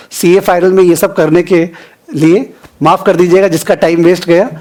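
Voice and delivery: ordinary delivery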